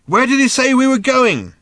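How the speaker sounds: high, loud yelled question; shouting. A narrator's reading. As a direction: shout into a companion's ear. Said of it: "Ford shouted in Arthur's ear".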